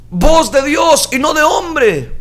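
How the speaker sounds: high, drawn-out acted cry; crowd shouting. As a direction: call it out slowly like an acclaiming crowd. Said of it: "aquellos empezaron a gritarle". (grito alargado de pueblo que aclama)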